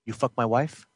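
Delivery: low, quiet, cold menace; menacing low question. Acted. Jealous brother quietly accuses him, low menace. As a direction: ask it low and threatening. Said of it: "End scene".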